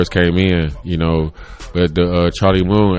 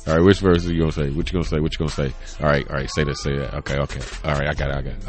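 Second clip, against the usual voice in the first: quiet self-talk; 5 semitones lower, 6 dB quieter, much faster than usual. Retold or imitated. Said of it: "say the verse".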